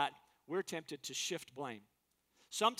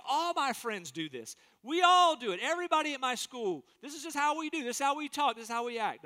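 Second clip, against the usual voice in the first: put-on higher, louder whiny teenager; defensive teen excuse; 6 semitones higher, 5 dB louder than usual. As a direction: plead like a kid caught out by everyone. Acted peer-pressure excuse.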